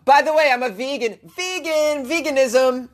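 high-pitched, proud proclaiming; all-caps announcement. Acted. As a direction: announce it in obnoxious capital letters. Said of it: "You write in all caps".

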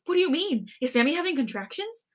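high, flustered excited questioning; excited, laughing. A narrator's reading. Oma questioning her son about the baby, excitedly. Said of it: "she laughs and stammers through her words".

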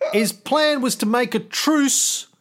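ordinary talk, nothing marked

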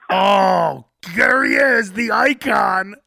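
loud, mock-awed exclamation; put-on fanfare, drawn out at the end; feigned impressed. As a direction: gush as if greatly impressed by his entrance. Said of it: "everyone has to act impressed".

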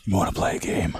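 low, unvoiced horror villain; creepy horror whisper. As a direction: whisper it like a movie killer. Horror movie quote.

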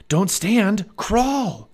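high, loud warning; urgent command. A narrator's reading. Jack stops Annie from standing, urgent and loud. Boy urgently warns sister in danger.

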